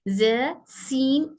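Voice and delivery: "ज, सीन" drawn out slowly, each Arabic letter sound articulated with the tongue tip; slow letter demo. Shown as a demonstration. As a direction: spell out "ज" and "सीन" very slowly for students. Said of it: "tip of the tongue से अदा होने वाले हरूफ". ("ज, सीन" को धीरे-धीरे, साफ़ मखरज से अदा करें)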